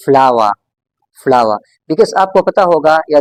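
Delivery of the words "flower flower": flower as "flah-wah", lower and falling; British silent R. Shown as a demonstration. Teacher giving the actual pronunciation, silent R. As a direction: let flower end on an open vowel, no R. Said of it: "This is actually pronounced as".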